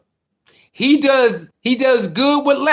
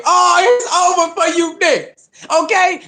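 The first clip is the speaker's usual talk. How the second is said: gloating; 7 semitones higher than usual. Real, not acted. Taunting rival fans, high gloating. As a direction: rub it in, pitched way up.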